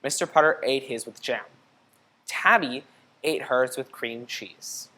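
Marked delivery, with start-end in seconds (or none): none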